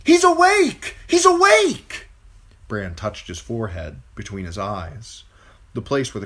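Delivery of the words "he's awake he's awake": high, loud excited shout. A narrator's reading. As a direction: call it down the stairs.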